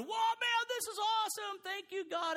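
high, loud joyful outburst; gushing thanks to God; excited praise. Retold or imitated. Pastor voicing his own joyful praise, loud and high.

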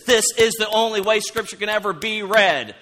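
imitating an opinionated man ending the conversation; opinionated know-it-all. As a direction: shut everyone down with certainty. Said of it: "somebody comes in and says".

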